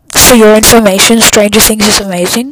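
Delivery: loudly insisting; emphatic loud. Real, not acted. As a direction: declare it with force.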